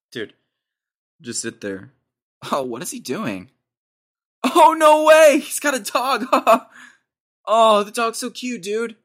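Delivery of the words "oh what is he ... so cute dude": high, loud squealing delight over a cute dog; excited squeal. Real, not acted. Watching a video, squealing at a dog. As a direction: gush over the dog at the top of the voice.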